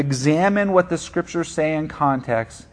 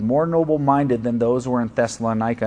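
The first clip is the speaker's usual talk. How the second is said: ordinary delivery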